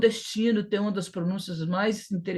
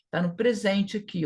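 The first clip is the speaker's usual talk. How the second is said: usual speaking voice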